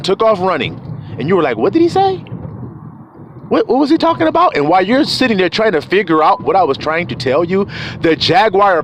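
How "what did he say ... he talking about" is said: high, quick puzzled voice; mock confusion. Friend who missed the warning, high baffled voice. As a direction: mimic a baffled listener up high.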